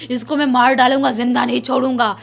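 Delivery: loud threatening possessed voice; the spirit yells threats through the girl; menacing shout. Retold or imitated. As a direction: roar the threats at the family. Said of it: "जोर से चिल्ला रही थी".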